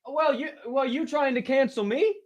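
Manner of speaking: teasing the chat as if wronged; mock accusing. Real, not acted. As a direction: play offended in a high voice.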